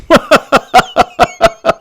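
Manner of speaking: high, wild, airy cackle from a locked-up relative; crazed cackle from upstairs. Retold or imitated. Imitating Uncle Ned's crazed cackle upstairs. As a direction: let out an unhinged laugh, way up high. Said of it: "If you hear this".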